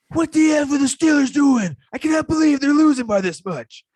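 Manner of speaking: very high, loud fan rant; imitated outraged yell. Bar fan watching the Steelers lose, imitated outraged yell. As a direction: mimic a fan shouting in disbelief.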